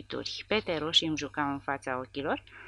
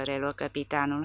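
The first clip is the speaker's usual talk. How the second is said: ordinary delivery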